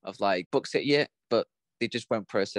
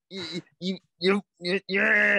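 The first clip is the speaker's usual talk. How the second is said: higher, clumsy Scottish accent attempt; bad Scottish accent; 7 semitones higher, 3 dB louder, slower than usual. Host doing Conrad's Scottish line to the co-host, bad Scottish accent. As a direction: fumble a Scottish accent badly. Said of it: "we should not attempt accents".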